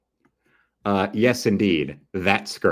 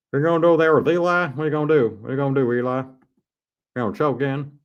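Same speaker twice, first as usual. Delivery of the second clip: taunting; 5 semitones higher, faster than usual. Real, not acted. Taunting Eli on the TV replay, fast and high. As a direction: needle him as he runs up.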